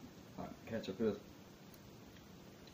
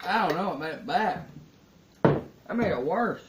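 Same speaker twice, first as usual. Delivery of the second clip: mouth burning from spicy food; 8 semitones higher, 7 dB louder than usual. Real, not acted. Pickle made burning worse.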